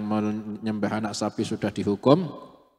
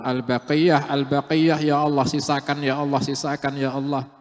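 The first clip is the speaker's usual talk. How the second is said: loud, higher begging prayer; pleading; 5 semitones higher, 5 dB louder than usual. Acted. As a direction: beg God to spare the rest. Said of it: "Nabi Musa itu mohon-mohon". (memohon dengan suara keras dan tinggi)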